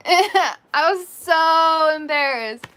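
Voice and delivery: slow, laughing through the words; laughing embarrassed. Real, not acted. Telling viewers the story, laughing and embarrassed. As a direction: laugh it out, stretched. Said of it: "laughing while saying".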